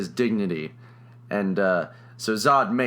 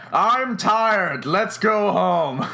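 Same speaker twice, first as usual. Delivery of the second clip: mock villain quitting; 7 semitones higher, much slower than usual. Zod calling off the fight, mock-weary villain call. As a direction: call it out big and drawn-out.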